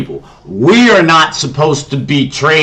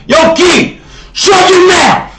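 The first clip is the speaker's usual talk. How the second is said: high, explosive shout; real yell; 6 dB louder, slower than usual. Real, not acted. Breaks off the video to tell Key to shut up, real angry yell. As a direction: yell a sharp order across at someone.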